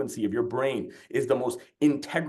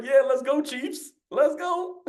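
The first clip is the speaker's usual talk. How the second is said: high, excited cheer for the Chiefs; playful football fan cheering much higher; mock game cheer; 12 semitones higher, slower than usual. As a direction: whoop it up like a fan on the couch. Cheering on football team while chilling.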